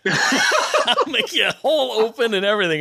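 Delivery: high-pitched, through real laughter; talking while cracking up; laughing. Real, not acted. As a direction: laugh your way through the offer. Repeats the offer through laughter.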